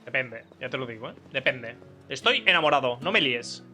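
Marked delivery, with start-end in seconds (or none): none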